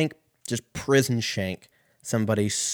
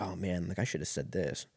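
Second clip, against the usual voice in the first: regretful mutter; 4 semitones lower, 10 dB quieter than usual. Retold or imitated. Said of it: "you're like".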